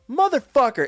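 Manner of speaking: angry yell. Retold or imitated. Customer storms over to the employee, angry yell. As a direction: spit it out at the guy who took your copy. Confronting employee who took copy.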